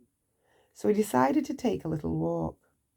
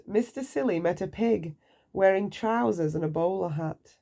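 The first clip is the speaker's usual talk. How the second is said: ordinary delivery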